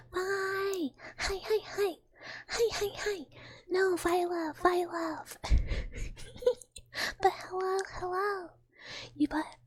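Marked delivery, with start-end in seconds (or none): 0.1-3.3 high, gushing hellos to a pet; squeaky delighted hi to the pet; excited pet greeting. Real, not acted. 3.7-8.5 playful high scolding of Viola; talking to the pet. Real, not acted. Scolding then greeting pet.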